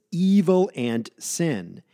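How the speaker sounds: slow down and hit "evil", then "sin"; stress on evil and sin. Shown as a demonstration. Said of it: "please notice the emphasis".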